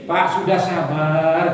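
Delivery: low, slow calming voice; soothing doctor. Acted. Doctor calming a wailing patient, soothing. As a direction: stretch it out soothingly. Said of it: "katanya dokter".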